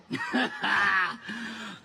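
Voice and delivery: low warm chuckle; laugh. Acted. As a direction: let a soft laugh roll out. Laughing after cute remark.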